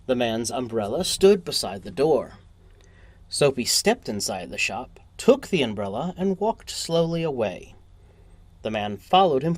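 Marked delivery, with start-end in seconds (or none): none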